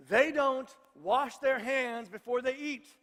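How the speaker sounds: each word slowed right down and leaned on; slow emphatic reveal. Acted. Preacher to congregation, a slow, emphatic reveal. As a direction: drag it out for the reveal. Punchline of the hand-washing reveal.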